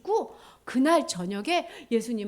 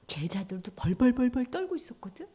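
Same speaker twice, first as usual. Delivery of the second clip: normal talking voice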